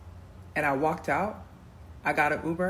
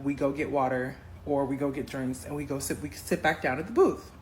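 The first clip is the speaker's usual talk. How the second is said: ordinary talk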